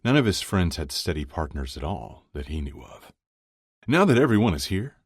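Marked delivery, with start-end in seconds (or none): 3.9-5.0 high, loud, cheerful toastmaster; booming, happy voice. A narrator's reading. Chuck calls the friends to attention, booming and happy. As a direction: boom it out happily to the table. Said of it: "in his booming, happy voice".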